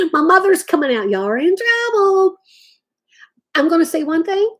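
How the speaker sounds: a lightly hammed-up mother calling out; mocking mother. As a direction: play the warning mom calling the kids. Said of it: "That reminds me of my mother".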